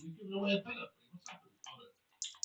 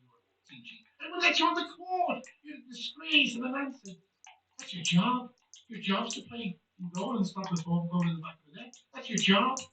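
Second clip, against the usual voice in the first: indignant pundit's telling-off, rising and dropping in pitch; Roy Keane impression. Pundit berating a goalkeeper, indignant Roy Keane impression. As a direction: scold the keeper like a disgusted pundit. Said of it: "best Roy Keane impression".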